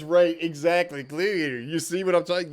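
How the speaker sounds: usual speaking voice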